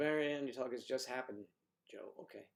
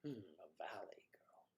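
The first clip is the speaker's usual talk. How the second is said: whisper; 5 semitones lower, 14 dB quieter than usual. Real, not acted. Repeats the remark to himself.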